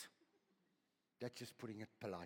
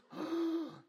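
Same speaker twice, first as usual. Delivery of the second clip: sharp high gasp of wonder; awed gasp; 8 dB louder than usual. Acted. Preacher marvels at the verse, a sharp gasp.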